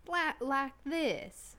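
very drawn-out Southern drawl. Shown as a demonstration. Player demonstrating the costume accent, slow Southern. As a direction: stretch it slowly in a Southern accent. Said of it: "It's a little slower".

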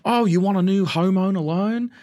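exaggerated, drawn-out Aussie imitation; broad mock-Australian question; Australian accent. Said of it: "these Australian guys having a conversation".

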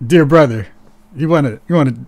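ordinary talk

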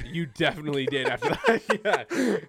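laughing while teasing. Real, not acted. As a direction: giggle through the words.

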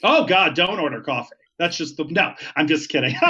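normal talking voice

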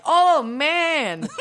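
high, loud drawn-out "oh, man"; big exclamation. Shown as a demonstration. Co-host showing what Igor should say, big loud exclamation. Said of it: "what he should be saying is like".

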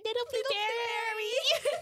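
put-on slow sweet baby-talk coo; high cooing voice. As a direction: coo the pet name. Cooing the dragon's pet name.

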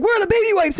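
high, panicky mock wail; retold panic. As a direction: frantically demand it, pitch shooting up. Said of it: "there was, like, this panic".

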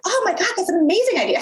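high, quick burst of excitement; excited. Retold or imitated. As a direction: jump on the idea, pitch soaring. Retold eager reply to invitation.

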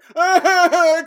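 loud, high fake wailing; hammed-up bawling in mockery of an actor; mock crying. Podcaster mimicking the actor on the stairs, mock crying. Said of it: "crying on this thing".

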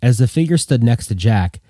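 plain reading voice

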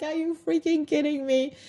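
high-pitched "freaking" in delighted shock; amazed disbelief. Retold or imitated. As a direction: squeal out the disbelief. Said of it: "I'm like".

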